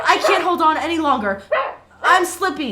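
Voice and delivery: high, loud and desperate; panicked straining. Acted. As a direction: cry out while slipping. Dangling inside the well.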